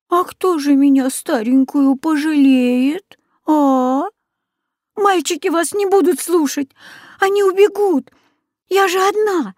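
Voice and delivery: put-on very high, pleading child; girl pleading with the witch; tearful girl. A narrator's reading. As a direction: beg through tears.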